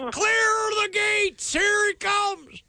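high, slow announcing yell; mock shout. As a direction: call it out like a lookout. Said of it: "just have him say".

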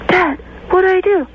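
high, pleading son voice; clueless son. Acted. Son on a walkie-talkie asks Dad for help, high and helpless. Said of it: "And then he goes".

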